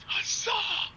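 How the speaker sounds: big drawn-out mock shout; battle cry. Brainless dragon knight with only a battle cry, mock shouting. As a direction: hurl it out like a himbo's war cry. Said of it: "this boy's gonna start fucking shouting".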